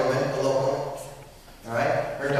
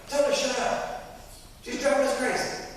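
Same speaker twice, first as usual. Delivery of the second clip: annoyed complaint; 10 semitones higher than usual. Retold or imitated. Annoyed apostles complain to Jesus.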